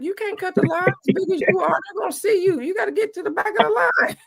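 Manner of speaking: high, scolding mom voice, hammed up; high mock mom. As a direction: squeak out mom's warning about the line. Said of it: "She'd be like".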